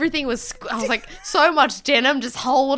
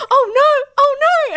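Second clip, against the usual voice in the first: high, louder laughing panic; panicked oh no; 12 semitones higher, 6 dB louder than usual. Retold or imitated. Peeing in an alley, panicking as a couple approaches, high laughing alarm. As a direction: squeal it in mock alarm as they approach. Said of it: "I was like".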